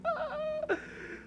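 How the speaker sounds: very high, squeaky laugh; thin high giggle after a long laugh; helpless laugh. Real, not acted. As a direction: crack up at your own embarrassment. Cracking up at own story.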